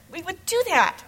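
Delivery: put-on high, eager kids answering yes; eager children.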